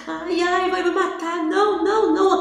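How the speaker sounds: high, panicked cry of fear; retold terrified cry. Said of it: "comecei a gritar".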